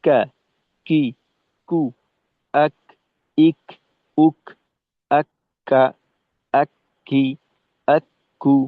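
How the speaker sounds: qaf drilled as কা কি কু আক আক্কা with the doubled k held; each syllable clipped apart, আক্কা with a held double k; letter drill. Shown as a demonstration. Tajweed teacher showing students the letter কা. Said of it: "কাফ এবং তা".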